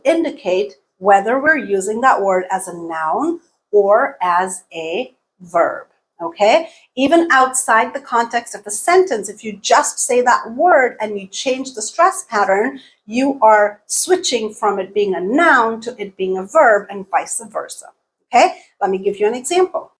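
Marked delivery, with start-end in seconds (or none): none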